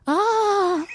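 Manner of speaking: high, drawn-out acted cry; exclaimed "Ah!". A narrator's reading. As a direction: let out a long high "ah" as the heroine. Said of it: "she exclaimed".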